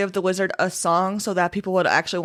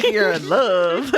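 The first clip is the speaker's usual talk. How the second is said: slow, laughing tease; 2 semitones higher, 4 dB louder, slower than usual. Real, not acted. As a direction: laugh through the words. Teasing friend, laughing.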